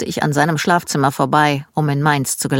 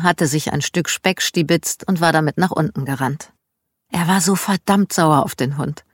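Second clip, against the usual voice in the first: usual speaking voice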